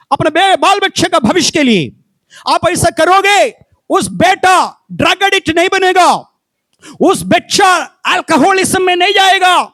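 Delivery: rousing sermon voice, climbing in force; full-force preaching. Acted. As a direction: preach it out hard to the congregation. Preaching to parents.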